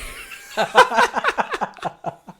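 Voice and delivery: high burst of laughter; laughing. Real, not acted. Podcasters reacting to the dad's Brita filter question, real laughter. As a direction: crack up for a couple of seconds.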